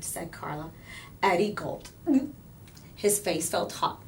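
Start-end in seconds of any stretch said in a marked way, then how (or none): none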